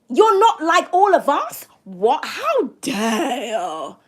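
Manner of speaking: mock-appalled, very drawn-out "dare"; crazy people's outrage. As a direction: act scandalised, stretching the words slowly. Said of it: "channeling what these crazy people are saying".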